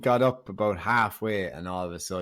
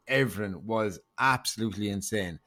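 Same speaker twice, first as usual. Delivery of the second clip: usual speaking voice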